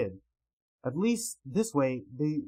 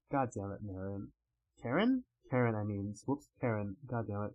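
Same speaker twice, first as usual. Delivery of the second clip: muttered self-correction; 6 semitones lower, 6 dB quieter than usual. Real, not acted. Cursing own slip with names.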